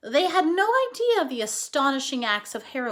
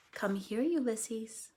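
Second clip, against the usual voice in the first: soft, gentle call; coaxing; 3 semitones lower, 7 dB quieter, slower than usual. Acted. Flora holding out her hand to the squirrel, soft coaxing. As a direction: coax the squirrel over softly.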